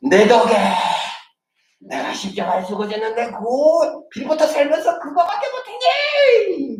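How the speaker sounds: mock reproach building to a wail; hammed-up scolding. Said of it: "예수님께서 막 우리한테 와갖고". (점점 높아져 울부짖는 과장된 나무람)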